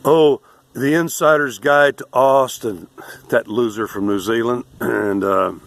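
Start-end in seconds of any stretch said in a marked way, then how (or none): 0.1-2.9 high, loud mocking tourist voice; mock tourist vlogger. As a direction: sneer at the YouTube visitor. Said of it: "go like".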